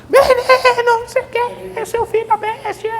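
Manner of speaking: very high, shrieking mock furious mother; losing control. A mother losing control at her pestering son, shrieking. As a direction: blow up at the kid in a hammed-up shriek.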